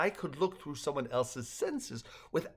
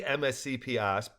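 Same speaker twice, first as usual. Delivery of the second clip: usual speaking voice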